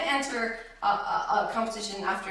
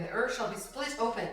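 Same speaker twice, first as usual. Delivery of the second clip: ordinary delivery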